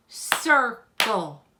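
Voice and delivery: Circle split into two separate, firm beats; clapped-out syllables. Shown as a demonstration. Teacher clapping out the word for children, Circle in two beats. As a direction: break Circle in two, a gap between the syllables. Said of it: "We did two syllables for the word".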